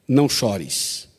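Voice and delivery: soft, slow, tender comfort. Retold or imitated. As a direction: console a grieving mother gently. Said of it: "foi muito carinhoso". (consolo terno, suave e lento)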